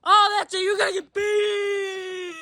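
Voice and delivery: loud, high, slow threatening yell; hammed-up threat of a beating; mock threat. Threatening the cartoon dog after the hang-up, slow mock yell.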